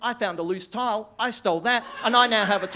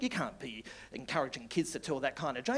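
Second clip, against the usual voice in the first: ordinary talk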